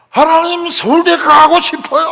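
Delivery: high, loud plea to God; demonstrating a student's hollered prayer; shouted prayer. Shown as a demonstration. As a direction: yell the wish out at full voice. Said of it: "왜 소리를 안 지르냐?".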